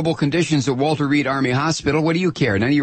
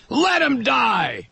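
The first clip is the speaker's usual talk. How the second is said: mock heckler shout; 7 semitones higher than usual. Ranter mimicking right-wingers shouting down health care. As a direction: ham up the heartless crowd. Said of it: "what you all scream out".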